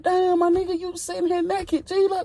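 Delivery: high, far-off voice for his own shocked self; mock high startled voice. Cellmate naked, speaker confronts.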